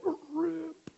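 high-pitched vocal tearing noise; imitated ripping sound. Shown as a demonstration. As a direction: make the sound of a heart tearing. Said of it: "your heart is like".

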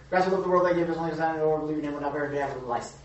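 gabbling the verse at top speed; mocking a rushed read. Shown as a demonstration. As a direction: race through it like a bored teen. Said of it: "this is like the Bible".

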